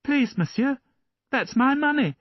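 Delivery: put-on high, polite little boy asking for his coin; small boy. A narrator's reading. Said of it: "Valjean lowered his head".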